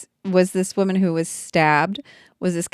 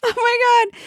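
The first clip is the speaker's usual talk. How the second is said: high laughing cry of disbelief; laughing shriek. Real, not acted. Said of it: "reaction to the ad".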